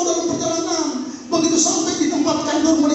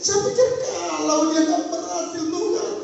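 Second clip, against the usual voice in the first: much higher, pleading lament; 10 semitones higher than usual. Retold or imitated. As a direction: complain to God in distress. Pastor lamenting to God. (gembala mengeluh kepada Tuhan, nada sangat tinggi)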